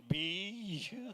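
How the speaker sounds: nasal siren beep mimic; mimicked siren. Mocking the ambulance driver, siren buzz. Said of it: "he's going".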